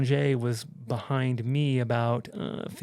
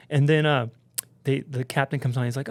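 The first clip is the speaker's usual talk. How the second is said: ordinary delivery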